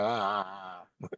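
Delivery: soft, low mock crow caw; a quiet, throaty imitation of cawing crows; imitating crows. Naming crows as the other birds, soft mock caw. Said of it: "Why do other birds go".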